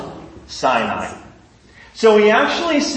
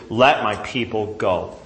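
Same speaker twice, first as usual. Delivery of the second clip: low solemn command; 8 semitones lower, 4 dB quieter than usual. Acted. God's command voiced by preacher.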